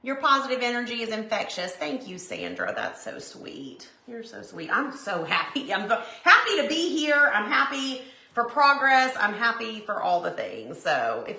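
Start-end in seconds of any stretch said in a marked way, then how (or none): none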